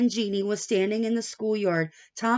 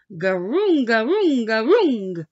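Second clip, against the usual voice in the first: croaky chant; 6 dB louder than usual. Acted. Chicken feet opening and closing.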